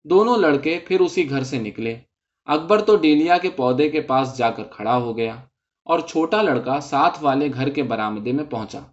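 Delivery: plain reading voice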